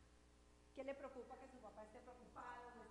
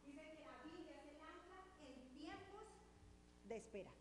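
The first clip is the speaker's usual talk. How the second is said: much softer, almost to herself; softly restated; 3 semitones higher, 7 dB quieter than usual. Real, not acted. Preacher repeats the key point to the church, softly. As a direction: let the key line sink in quietly.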